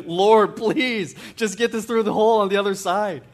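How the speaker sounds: loud, high, hammed-up plea to God; mock desperate prayer. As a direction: beg as if your house were burning down. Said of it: "I'm praying like my house is burning down".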